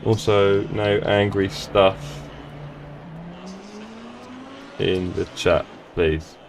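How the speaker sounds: low, slow, with long gaps; typing aloud. Real, not acted. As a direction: let the words trail behind your typing. Typing a message while saying it.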